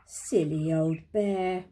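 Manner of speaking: low, slow and tender; loving. A narrator's reading. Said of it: "in such a loving voice".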